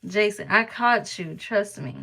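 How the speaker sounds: ordinary talk, nothing marked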